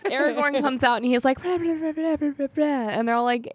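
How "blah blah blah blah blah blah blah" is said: high-pitched babble as fake Elvish; mock Elvish. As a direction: rattle off nonsense syllables in a raised voice. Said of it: "why are you speaking Elvish".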